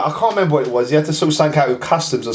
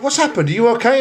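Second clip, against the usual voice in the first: retold worried question; 7 semitones higher than usual. Worried man asking.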